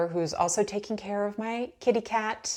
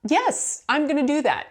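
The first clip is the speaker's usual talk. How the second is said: excited agreement; 7 semitones higher, 4 dB louder than usual. Retold or imitated. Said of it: "I was like".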